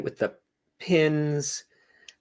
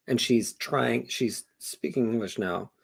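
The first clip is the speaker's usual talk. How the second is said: ordinary talk, nothing marked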